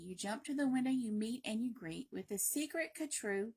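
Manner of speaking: plain reading voice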